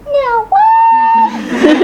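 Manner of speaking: very high, loud little squeal; tiny high-pitched kid shouting in triumph; little child's voice. Retold or imitated. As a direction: squeal it out like a small child's first words. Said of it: "I hear this little voice go".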